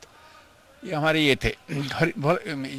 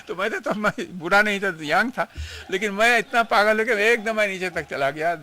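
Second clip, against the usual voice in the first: higher, louder, reliving the rush of joy; breathless excited recollection, higher and louder; excited retelling; 6 semitones higher, 5 dB louder than usual. Retold or imitated. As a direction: tell it like you still can't believe you ran down. Recalls running out in ecstasy.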